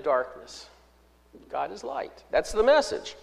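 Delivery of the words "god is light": much softer, almost under the breath; softly repeating God is light; quiet restatement. Acted. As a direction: let it drop to a hush. Restates message after proclaiming it.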